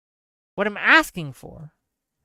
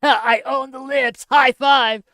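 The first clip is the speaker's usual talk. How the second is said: higher, hammed-up smug gloat; mocking gloater voice; 5 semitones higher, 4 dB louder than usual. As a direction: parody a gloating poster. Mocking gloating online posters.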